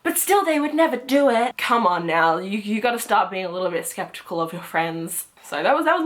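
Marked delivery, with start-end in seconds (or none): 0.0-1.6 higher, mock-naive protest; imitating denial. Kenzie defending her friends, imitated denial. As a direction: mimic someone refusing to suspect their friends. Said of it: "she was like".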